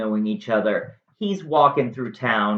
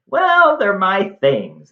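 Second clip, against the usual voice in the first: jumps much higher and louder on well, then slow; mock indignant Jason; 8 semitones higher, 7 dB louder, slower than usual. Jason protests to Medea about his things, mock-indignant high squeak.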